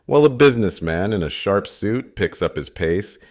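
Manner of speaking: usual speaking voice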